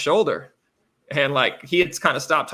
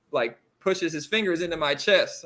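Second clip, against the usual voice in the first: ordinary talk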